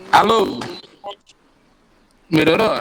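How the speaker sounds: loud mic-check call. Real, not acted. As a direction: check if they hear you. (chamando alto)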